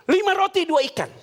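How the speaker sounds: high, eager blurted reply; high quick eager answer. Retold or imitated. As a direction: blurt it out spontaneously. Disciples blurt answer spontaneously.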